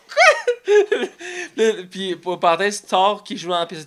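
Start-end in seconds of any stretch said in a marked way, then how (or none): 0.1-0.7 very high loud squeak; mock shocked. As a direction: shriek it in disbelief. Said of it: "T'es comme".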